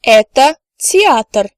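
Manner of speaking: "Это театр" said slowly and clearly; slow careful "Это театр"; slow model answer. Shown as a demonstration. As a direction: spell out the sentence for learners. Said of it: "The answer could be".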